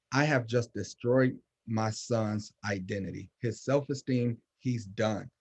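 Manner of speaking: ordinary talk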